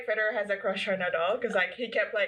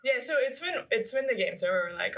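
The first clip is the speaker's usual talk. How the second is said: ordinary talk, nothing marked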